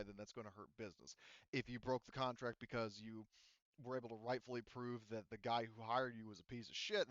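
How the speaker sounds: ordinary talk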